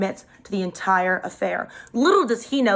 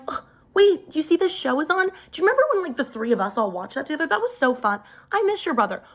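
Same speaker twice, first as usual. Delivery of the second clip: bright, much higher, gushy excitement; gushing; 6 semitones higher than usual. Retold or imitated. Baiting her boyfriend with fake nostalgic gushing. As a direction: gush like it's the best memory ever. Gushing about his brother on purpose.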